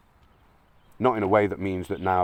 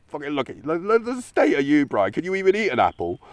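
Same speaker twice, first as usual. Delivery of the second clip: much higher, louder mocking jeer; mate taking the piss; 7 semitones higher, 5 dB louder than usual. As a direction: rib your mate with a high, loud teasing bray. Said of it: "if you were my mate and you were going".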